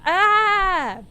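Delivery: high, loud "ah" of panic; loud cry. Real, not acted. Player reacts to the ruined phone call, crying out. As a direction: cry out at full voice.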